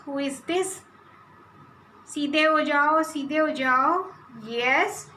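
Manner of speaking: normal talking voice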